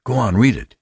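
high, eager urging; excited. A narrator's reading. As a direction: egg him on excitedly. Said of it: "Pete Klein said excitedly".

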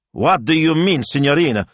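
high, loud alarmed question. Acted. Shop owner questions his assistant, alarmed. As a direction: demand it in rising panic.

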